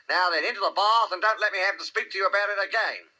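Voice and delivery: high-voiced motherly scolding; rather severe. A narrator's reading. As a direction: lecture a naughty child firmly. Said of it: "said Kanga rather severely".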